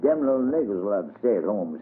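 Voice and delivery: plain reading voice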